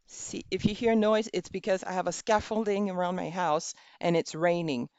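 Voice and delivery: usual speaking voice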